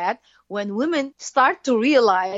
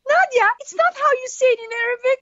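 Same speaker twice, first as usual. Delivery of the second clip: much higher, mock-schoolmarm correction; teasing correction; 4 dB louder than usual. Correcting her co-host's Arabic, a high teasing scold. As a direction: play it up, high and a little louder, wagging a finger. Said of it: "And I was like".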